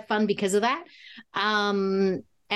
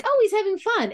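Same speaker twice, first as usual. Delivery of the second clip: much higher, delighted viewer reaction; delighted realization; 12 semitones higher than usual. Retold or imitated. Fan watching the actor cut loose, delighted realization. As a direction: gush it as a pleased discovery.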